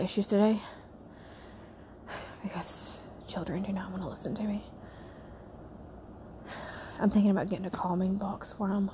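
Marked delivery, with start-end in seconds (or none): 2.4-8.9 soft unvoiced confiding aside; whisper. Real, not acted. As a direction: whisper it to the camera.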